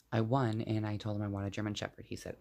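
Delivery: ordinary talk, nothing marked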